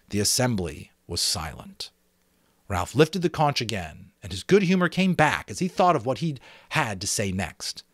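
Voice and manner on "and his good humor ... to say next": ordinary delivery, nothing marked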